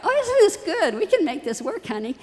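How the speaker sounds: a mother faking delight to hide her worry; forced cheer. Retold or imitated. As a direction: sell the bomb shelter as lovely. Forcing cheer for daughter at dorm.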